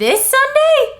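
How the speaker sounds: very high, loud, slow disbelief; hammed-up high panic; mock shock. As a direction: squeal it out in a panic.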